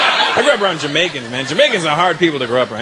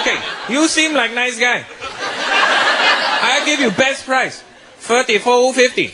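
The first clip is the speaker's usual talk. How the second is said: higher, slow mock Chinese shopkeeper; shopkeeper accent; 5 semitones higher than usual. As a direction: slowly offer your best price like a shopkeeper. Chinese shopkeeper offering best price.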